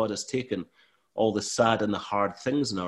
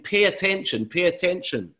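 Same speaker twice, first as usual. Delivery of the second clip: raised, urgent call for attention; shouting; 7 semitones higher than usual. Acted. Preacher voicing God calling out. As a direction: call out to get everyone's attention. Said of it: "God is shouting really loudly".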